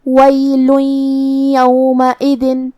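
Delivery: وَيْلٌ يَوْمَئِذٍ with the tanween merged into the ya under a nasal hum; idgham with ghunna. Shown as a demonstration. Teacher demonstrates merging the tanween with ghunna. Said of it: "یہ حکم کو ادا کریں گے".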